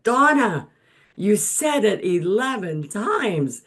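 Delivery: high, loud prim scolding; prim and proper. Retold or imitated. Carol scolding Donna after the broadcast, prim and shocked. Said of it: "Carol's very prim and proper".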